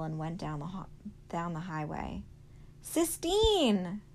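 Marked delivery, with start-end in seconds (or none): 2.8-4.0 high, loud call while running; shouting her name. Acted. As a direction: call her from far away, full voice. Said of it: "he shouted as he ran".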